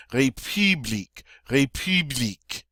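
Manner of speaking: "république" with "ee" through rounded lips in "pu"; French U. Shown as a demonstration. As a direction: pucker on the middle of "république". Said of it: "long E sound in English through rounded lips".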